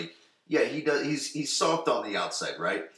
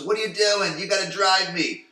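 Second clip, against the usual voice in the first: put-on higher, loud worried girlfriend; alarmed Brenda; 9 semitones higher, 6 dB louder than usual. Brenda objecting as Dylan pours brandy, high and loud. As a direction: plead with him not to drink. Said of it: "Brenda goes".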